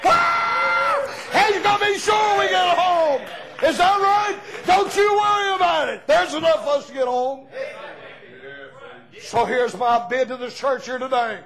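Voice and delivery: high, loud shouting preacher; full-force revival preaching. Acted. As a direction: whip the congregation up, calling out.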